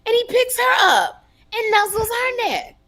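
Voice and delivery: loud, very high-pitched disbelief; indignant outburst. Real, not acted. Storyteller tells of him nuzzling her rival, indignant. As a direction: blurt it out high and outraged.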